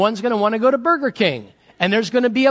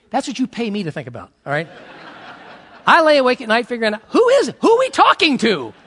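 ordinary talk, nothing marked

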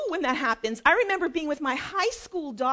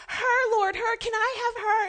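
high-pitched playful begging; eager pleading; 9 semitones higher, 3 dB louder than usual. Acted. Mother asking the Lord for her child, high and eager.

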